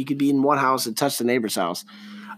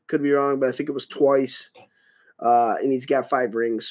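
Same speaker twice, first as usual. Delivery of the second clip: usual speaking voice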